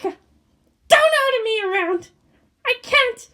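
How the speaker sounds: high slow strained refusal; defiant. Acted. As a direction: snap back in pain. Asuka defiant.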